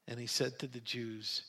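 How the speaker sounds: plain reading voice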